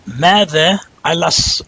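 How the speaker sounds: slow, careful Mada Alas with the lam carried over; drawn out Arabic Mada Alas; Arabic pronunciation. Shown as a demonstration. Teacher asks students if they hear it, slow demo. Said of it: "you hear that?".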